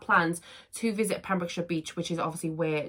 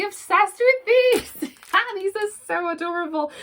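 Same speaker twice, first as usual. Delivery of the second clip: squealing delight; 13 semitones higher, 6 dB louder than usual. Real, not acted. Cooing over cute unboxing item.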